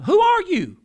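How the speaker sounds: pitched high, pressing who are you; demanding question. Retold or imitated. Priests pressing John, high impatient demand.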